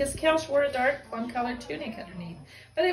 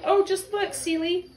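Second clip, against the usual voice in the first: higher and louder, bursting to point it out; excited cry; 9 semitones higher, 5 dB louder than usual. Acted. Lila calling to Celie in excitement, higher and louder. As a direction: call out in glee. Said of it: "Lila cried".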